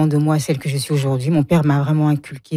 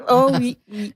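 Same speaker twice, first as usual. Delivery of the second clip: laughing; 8 semitones higher than usual. Real, not acted. Laughing agreement in interview.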